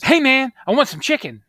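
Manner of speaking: loud, high imitation of an eager shout; hollered demand. Tommy flagging down a driver on the road, imitated holler.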